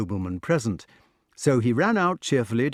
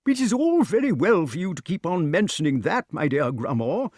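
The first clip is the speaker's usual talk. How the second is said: high, fussy complaint; petulant; 7 semitones higher than usual. A narrator's reading. Pellinore objects to Grummore's proclamation, petulantly. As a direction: protest in a peevish high voice. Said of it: "exclaimed King Pellinore petulantly".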